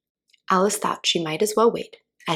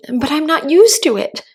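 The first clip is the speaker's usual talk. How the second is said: high, louder, pleading whine; piteous plea; 9 semitones higher, 8 dB louder than usual. A narrator's reading. Alice pleading with the caterpillar, piteous. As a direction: beg for pity. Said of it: "pleaded poor Alice in a piteous tone".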